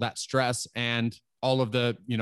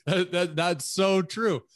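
laughing; 5 semitones higher, slower than usual. Real, not acted. Laughs while agreeing.

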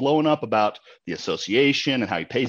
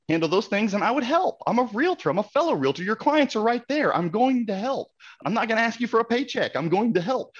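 much higher, pleading and emphatic; impassioned; 12 semitones higher than usual. Real, not acted. Telling the host he'd have helped the agent, impassioned and high. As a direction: insist you would have helped, pitch climbing. Passionate plea as a fellow realtor.

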